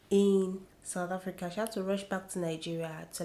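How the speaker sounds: ordinary talk, nothing marked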